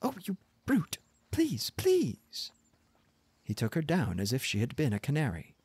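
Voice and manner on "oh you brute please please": very high-pitched wail; desperate pleading. A narrator's reading. Stranded wife begging her husband, high exasperated plea.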